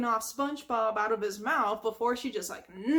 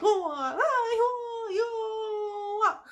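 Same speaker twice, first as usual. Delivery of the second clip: high, squeaky karate cries; karate grunts; 10 semitones higher, much slower than usual. Retold or imitated. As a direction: yelp through mock kung fu moves. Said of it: "his own little karate moves".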